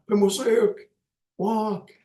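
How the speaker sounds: high, ringing order to a group; commanding call. Shown as a demonstration. Teacher showing students how to command a group, ringing call. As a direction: call it out like giving the class an order. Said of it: "like that".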